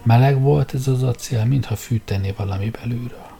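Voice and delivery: plain reading voice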